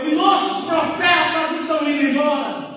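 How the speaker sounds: forceful, projected sermon voice; fervent preaching. Real, not acted. Preacher urges the church to seek God, fervent preaching. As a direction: preach with urgency, drawing out key phrases.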